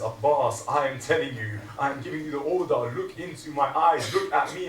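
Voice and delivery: loud, rising commanding plea; sister's order. Acted. Zainab ordering Abbas to look up, loud. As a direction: give him the order. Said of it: "Zainab then says".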